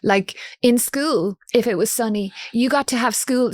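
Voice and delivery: ordinary talk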